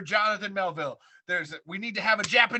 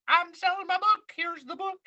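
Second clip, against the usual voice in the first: high, hammed-up book tour pitch; mock book seller; 12 semitones higher, 5 dB louder than usual. Fan imagining Octavio hawking his book, hammed-up seller voice. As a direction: put on a higher voice to hawk the book. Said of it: "I'm assuming you'll do little, like".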